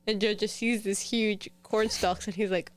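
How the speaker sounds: usual speaking voice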